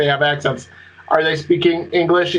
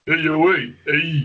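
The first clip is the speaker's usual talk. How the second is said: lightly voiced tough-guy interjections; Sylvester Stallone. Friend mimicking long-term prisoners, Stallone-like tough-guy voice. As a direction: play a short-worded prison tough guy. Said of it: "coming out more like Sylvester Stallone".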